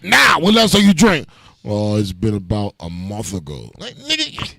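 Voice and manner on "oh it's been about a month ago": put-on drunk denial. Drunk friend denies drinking.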